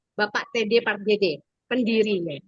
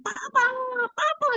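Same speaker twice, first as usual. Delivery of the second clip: very high mock kid yell; imitated child's yelling; 14 semitones higher than usual. Aunt imitating the boy's shouting, high mock yell. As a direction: call out like a small boy, not full volume. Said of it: "Kalau Matthew teriak kayak tadi".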